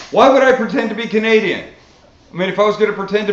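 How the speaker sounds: loud, high-pitched protest; indignant disbelief. Real, not acted. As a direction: throw the accusation back, loud and high. Rebutting a hater's accusation.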